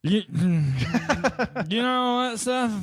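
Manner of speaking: holding court at the family table; embarrassing uncle. Pretend. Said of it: "I should be saying this in drunk uncle voice".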